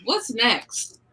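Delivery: ordinary delivery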